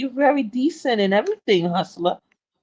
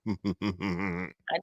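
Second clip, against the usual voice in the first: slow, much lower, softer complaint; mock sulk; 14 semitones lower, 13 dB quieter than usual. Complaining friends never answer, slow low mock sulk.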